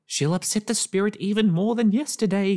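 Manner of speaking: high, loud alarmed cry. A narrator's reading. Neighbour crying out to the parents, high alarmed voice. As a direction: wail that the spirit will be angrier. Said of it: "cried Mister Tash".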